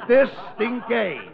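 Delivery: put-on distingué drawn out slowly with a French accent; French pronunciation. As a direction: savor distingué the French way, slow. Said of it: "as they would call you in French".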